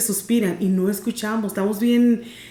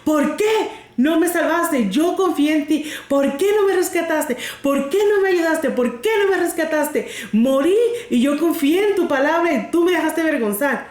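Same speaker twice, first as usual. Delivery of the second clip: put-on angry old woman; 6 semitones higher than usual. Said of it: "bien enojada".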